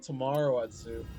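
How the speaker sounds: ordinary talk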